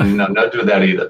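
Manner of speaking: ordinary delivery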